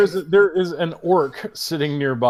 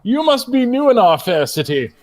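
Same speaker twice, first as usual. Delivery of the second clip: stuffy gentleman orc; lightly voiced haughty orc; pompous orc; 6 semitones higher than usual. Orc greeting the newcomer, stuffy. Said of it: "turns towards Stormweaver and goes".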